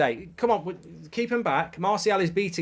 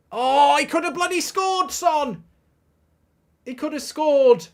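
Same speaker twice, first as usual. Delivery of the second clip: high, loud cry at a missed chance; exasperated football outcry; 13 semitones higher, 8 dB louder than usual. Real, not acted.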